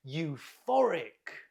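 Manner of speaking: "Euphoric" said slowly, stress on -PHOR-, for the class to echo; slow, clear "Euphoric", hitting -PHOR-; slow model for repeating. Shown as a demonstration. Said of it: "Can you repeat?".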